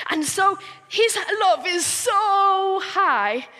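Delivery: high, slow emphasis on 'so high'; high and stretched. Shown as a demonstration. Telling children God's love covers mistakes, high and stretched. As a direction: lift the pitch and draw out 'so'.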